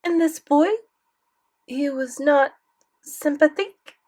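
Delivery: put-on high, French-accented innocent query; lightly innocent grandmother probing. A narrator's reading. Grandmother asking about the boy, innocently. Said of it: "she said innocently".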